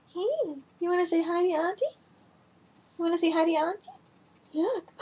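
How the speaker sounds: high sing-song pet voice. Real, not acted. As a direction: coax the cat to greet the viewer. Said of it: "I talk to my cats like they're children".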